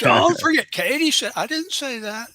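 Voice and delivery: mock protest. Joking about unprompted endorsement.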